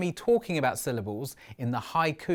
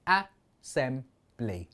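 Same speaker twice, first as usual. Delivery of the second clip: "Assembly" split slowly into three separate beats; slow, separated syllables in "Assembly"; 2 semitones lower, much slower than usual. Shown as a demonstration. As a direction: count out "Assembly" with a gap after each syllable. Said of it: "a great way to count syllables".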